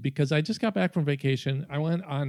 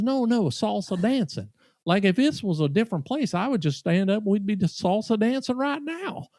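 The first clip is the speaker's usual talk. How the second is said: put-on Southern accent; 5 semitones higher than usual. Diner correcting the waitress about salsa, in a Southern accent. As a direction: imitate an insistent Southern enthusiast. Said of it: "in this Southern accent".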